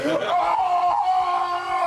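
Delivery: a high, laughing yelp of shock; mock outrage yelp; shocked laughing shout. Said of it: "And Kratos is like".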